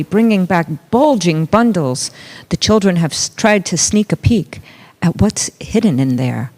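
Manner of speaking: plain reading voice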